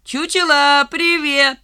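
высокий протяжный насмешливый крик; крикни издали, дразня; дразнящий оклик. Acted. Валька дразнит, отбежав. (насмешливо кричит издалека)

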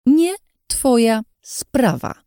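high, drawn-out words with a pause after each; slow, angry, separated words. A narrator's reading. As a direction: spell it out icily, one word at a time. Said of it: "wycedziła powoli, robiąc pauzy po każdym słowie".

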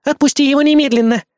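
loud, high order; commanding exclamation. Acted. Azumi orders Mark to release him, loud. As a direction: bark it as a general's command. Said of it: "воскликнула Азуми".

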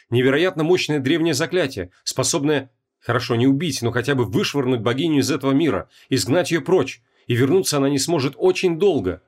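ordinary delivery

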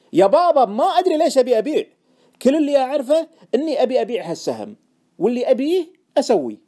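loud, high, defiant retort; heated insistence. Acted. Trader insisting on selling by hunch, loudly defiant. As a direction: shout back stubbornly, fed up.

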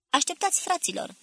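high, urgent cry to comrades; agitated call. A narrator's reading. Paul halts his comrades at the plane, agitated call. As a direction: call out in agitation, pitch jumping up. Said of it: "strigă Paul agitat, cu ochii ieșiți din orbite".

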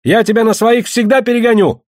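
high, heated boast; challenging taunt. Acted. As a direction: throw down a challenge to a rival. Rival shouts a racing challenge.

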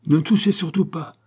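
high, urgent shout to stop; yelled warning. A narrator's reading. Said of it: "à grands coups de gueule".

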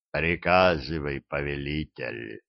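low, soft, defeated agreement; dejected. A narrator's reading. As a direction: give in, crushed and gloomy. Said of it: "понуро согласился Абдулла".

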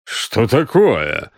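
drawn-out, mildly angry question. A narrator's reading. Грегсон demands an explanation at the card table, angry. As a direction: ask it slowly, with a touch of anger. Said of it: "сердито спросил Грегсон".

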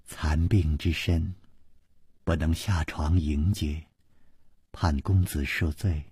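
low, soft and slow consort's voice; faintly smiling. A narrator's reading. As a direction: apologise gently and graciously, as if too frail to rise. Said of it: "微微一笑". (柔弱低缓，含着浅笑致歉)

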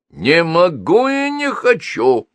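a lightly voiced grating creak, slow; creaky sneering refusal, winding down; creaking at low revs. A narrator's reading. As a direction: scrape it out like a slowing power saw. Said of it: "проскрипела электропила уже на малых оборотах".

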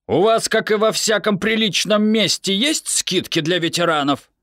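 a fussy, hopeful father; father's voice. A narrator's reading. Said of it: "произнёс отец". (голос отца, чуть выше)

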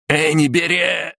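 high, slow yell; drawn-out cry. Acted. Fighter crying out as the spider leaps, in a slowed yell. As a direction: stretch it into a high shout.

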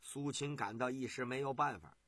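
ordinary delivery

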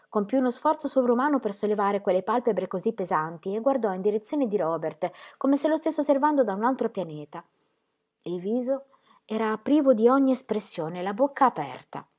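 ordinary delivery, nothing marked

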